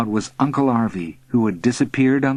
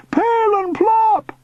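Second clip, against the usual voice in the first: very high, loud, drawn-out call; high shout; 4 dB louder than usual. A narrator's reading. Uncle carried upward, shouting. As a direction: shout it down from the air. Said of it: "he shouted".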